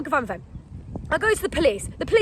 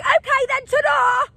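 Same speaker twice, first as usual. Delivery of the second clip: high, loud mock yell; shrieked, exasperated ta-da; re-enacted sarcastic goodbye; 12 semitones higher, 6 dB louder, slower than usual. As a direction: fling the farewell back at them. Her angry echo of the police.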